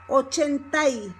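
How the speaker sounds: "Ochenta y" ending in a stressed "tai"; Y joined to A. Shown as a demonstration. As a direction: glide "a" into "y" in "Ochenta y". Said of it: "becomes together with the A".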